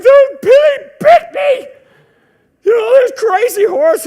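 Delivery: very high, loud frantic complaint; panicked outcry. Retold or imitated. Bitten kid shrieks to the adults that the horse bit him. Bitten boy tells rescuers.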